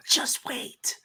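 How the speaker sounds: whispered, ominous teasing; hushed warning. Real, not acted. Older host warning co-host about his fifties, hushed and ominous. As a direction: warn him under your breath.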